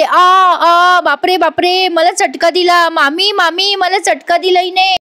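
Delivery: high, loud mock cry of pain; deliberately staged yelp for attention; fake loud yelling. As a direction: ham up a fake burn scream. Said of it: "मुद्दाम मोठ्याने ओरडते".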